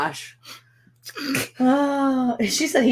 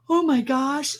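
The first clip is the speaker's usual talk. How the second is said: higher, half-joking dismayed gasp; mock dismay; 9 semitones higher, slower than usual. Mom sees the giant nose, higher mock dismay. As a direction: play up the dismay. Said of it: "and I'm like".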